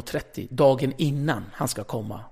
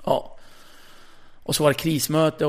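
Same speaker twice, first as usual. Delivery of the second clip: normal talking voice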